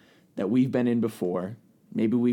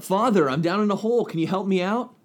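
higher, pleading call for help; mock shout up; 7 semitones higher than usual. Man in a hole to a passing priest, acted shout. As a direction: call up to someone above, storytelling scale. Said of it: "the guy shouts up".

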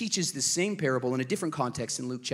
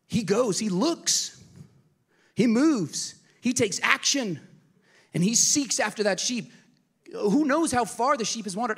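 rising intensity; 7 semitones higher, 3 dB louder than usual. Real, not acted. Building sermon climax.

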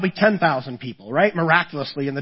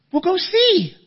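high, pushy mock wife voice; wife urging; 13 semitones higher, slower than usual. Wife sending husband to check the noise, high pushy voice. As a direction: push him to go look.

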